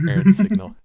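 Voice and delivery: loud, high sudden call; excited. Real, not acted. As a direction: blurt it out with a jolt. Loud burst naming a video.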